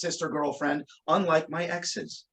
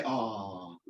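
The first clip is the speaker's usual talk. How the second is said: low, soft, drawn-out coo; sympathetic aww; 13 semitones lower, 9 dB quieter than usual. Shown as a demonstration. Performer cueing everybody to coo, sympathetic aww. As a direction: lead the crowd in a falling aww. Cues volunteer's sympathetic sigh.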